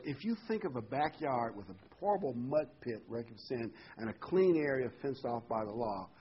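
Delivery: ordinary delivery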